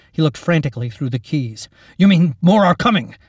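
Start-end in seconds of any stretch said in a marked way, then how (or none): 2.0-3.2 raised, loud, incredulous question; alarmed shout. A narrator's reading. Captain asking Lacey if more are coming, alarmed shout. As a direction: call it out in alarm.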